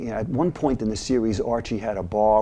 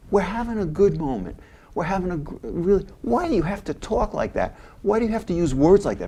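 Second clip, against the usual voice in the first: higher, pleading protest; Michael pleading; 8 semitones higher than usual. Retold or imitated. Michael begging Archie to drop the slurs, higher and pleading. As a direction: plead with him to stop. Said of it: "And so Michael says".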